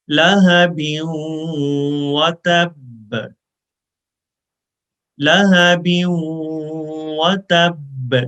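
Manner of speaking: slow drawn-out recitation; slow Quran recitation. Shown as a demonstration. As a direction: recite slowly, holding the pause on و. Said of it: "one second کے برابر رکیں گے".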